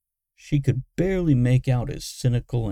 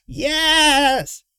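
high, loud villain shriek; frenzied; 7 dB louder than usual. A narrator's reading. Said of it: "Derek was in a frenzy now".

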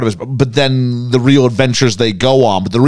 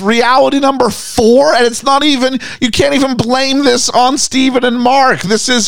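can't believe what she's seeing; stunned exasperation; disbelief; 11 semitones higher than usual. Real, not acted. Stunned by the hospital twist.

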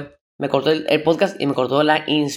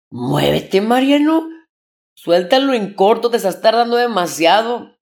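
higher and louder, pushy hurry-up; impatient scolding; 6 semitones higher, 4 dB louder, slower than usual. Retold or imitated. Editing his podcast, he scolds himself loudly to hurry. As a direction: snap at yourself to get on with it, slowly and loud.

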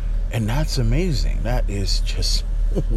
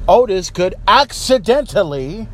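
ranting shout; 10 semitones higher than usual. Real, not acted. Ranting, mocking Otis as champion.

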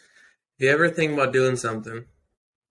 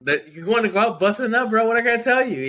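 excited; 9 semitones higher than usual. Real, not acted. Excited retelling.

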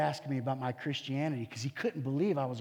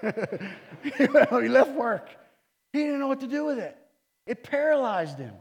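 excited laughing; 8 semitones higher, 8 dB louder than usual. Real, not acted. Laughing, excited retelling climax.

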